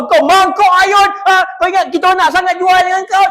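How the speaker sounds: high, loud mock rant at the customer; mock furious yell. Staff yelling at a customer, hammed-up fury. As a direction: scream it like a staff member losing it.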